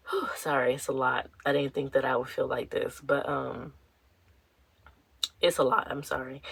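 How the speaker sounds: soft, subdued, holding back tears; tearing up. Real, not acted. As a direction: apologise quietly as real tears well up. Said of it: "tearing up".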